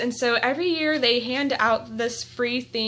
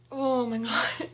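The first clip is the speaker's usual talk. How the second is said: ordinary delivery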